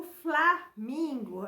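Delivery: slow "flamingo" with a loud, separated FLA; stress on FLA. Shown as a demonstration. Teacher pointing out F, L and A, syllable stressed. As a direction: punch FLA, then finish "flamingo". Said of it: "em voz alta para perceber os sons".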